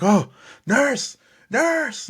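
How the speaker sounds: high, loud, panicky call for the nurse; panicked yell. Retold or imitated. Owner panicking as the dog gags, yelling high and loud for the nurse. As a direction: shriek for help in a panic. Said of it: "nervous and panicking".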